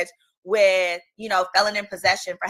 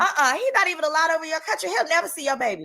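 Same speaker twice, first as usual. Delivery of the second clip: voicing Jesus; 7 semitones higher, faster than usual. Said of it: "'cause Jesus knew".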